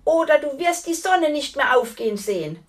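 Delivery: loud, high threat; calling out. A narrator's reading. Knight threatening the giantess, loud high warning. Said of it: "rief die Ritterin".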